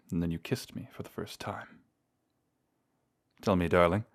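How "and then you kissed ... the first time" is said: very soft, intimate murmur; hushed tenderness. Acted. Vampire recalling their first kiss to lover, hushed. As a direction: let the fond memory fall almost to nothing.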